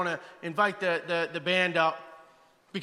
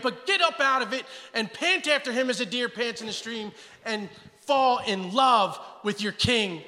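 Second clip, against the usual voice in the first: usual speaking voice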